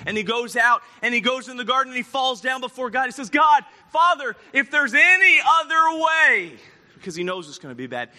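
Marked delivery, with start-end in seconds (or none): 3.3-6.6 loud, high cry to God; pleading prayer. Acted. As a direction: call out desperately to the Father. Acted anguished prayer in the garden.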